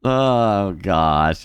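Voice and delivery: ordinary talk, nothing marked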